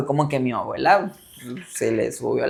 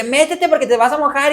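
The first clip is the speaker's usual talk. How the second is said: higher, urging him indoors; a grandmother nagging in the rain; mock scolding grandma; 10 semitones higher than usual. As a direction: tell him off to come inside.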